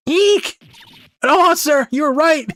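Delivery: put-on high-pitched little girl shriek, loud; scared shriek. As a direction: squeal in hammed-up fright at a monster.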